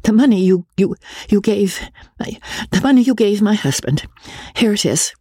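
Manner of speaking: high, tripping over each word; stammering. Acted. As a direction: falter and repeat, flustered. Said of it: "she stammered".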